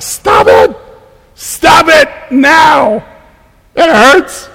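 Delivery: high shrill shout of aching legs, slow and loud; screaming legs. Retold or imitated. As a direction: yell it like your legs are begging you to stop. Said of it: "my legs start screaming".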